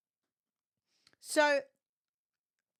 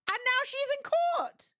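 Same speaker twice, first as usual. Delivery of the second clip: much higher, slower, disbelieving; incredulous punchline; 13 semitones higher, 4 dB louder than usual. Real, not acted. Streamer telling chat the absurd outcome, incredulous. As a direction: land it like you can't believe it.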